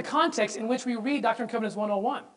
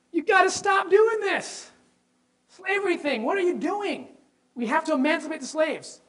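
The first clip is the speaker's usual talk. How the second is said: urgent Joseph; 6 semitones higher than usual. Retold or imitated. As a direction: press the plea high and slow. Joseph's platform voiced urgently.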